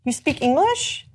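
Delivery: imitating stunned disbelief, rising; mock astonishment, pitch climbing to the end; surprised. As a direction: stammer it as a slow, astonished question. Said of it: "when they're surprised that you suddenly".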